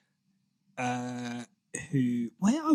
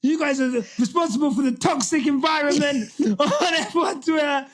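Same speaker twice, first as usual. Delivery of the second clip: higher, laughing through a mock telling-off; laughing mock accusation; 10 semitones higher than usual. Host teasing co-hosts over toxic F1 Twitter, laughing mock scold. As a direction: scold them while cracking up.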